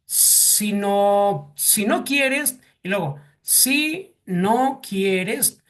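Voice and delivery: si no quieres, drawn out slowly; teacher slowing right down on si no quieres; slow demonstration. Shown as a demonstration. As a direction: spell out si no quieres slowly for the class. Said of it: "son palabras que también son significados de un escollo".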